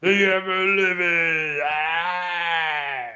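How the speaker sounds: slow, booming villain proclamation; dramatic shout. Pretend. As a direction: bellow it like a cartoon villain. Joining the mock incantation.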